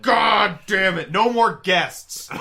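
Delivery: loud, slow, laughing mock fury; mock outrage. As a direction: bellow it in fake outrage.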